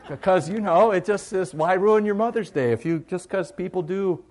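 high, loud comic tirade from the pulpit; mock-outraged preaching. Pastor to congregation about food mixing, mock-outraged. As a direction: preach it hard, as if it were a grave sin.

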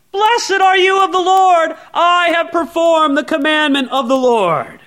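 loud, high, self-satisfied greeting; boastful Saul. Retold or imitated. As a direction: crow it like a proud king. First take of boast.

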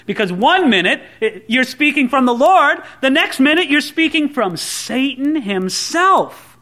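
ordinary talk

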